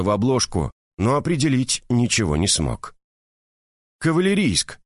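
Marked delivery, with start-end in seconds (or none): 4.0-4.8 high, sudden blurted guess. A narrator's reading. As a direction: fire off the guess. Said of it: "наконец выстрелил он наугад".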